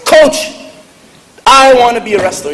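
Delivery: put-on high, loud boyish declaration; determined kid. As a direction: burst in and announce it to the coach. Kid returns to coach, announces again.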